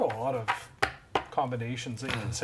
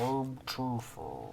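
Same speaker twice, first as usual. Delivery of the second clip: Tifa voice; 3 semitones lower, 6 dB quieter, much slower than usual. Pretend. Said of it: "That's definitely what she sounds like".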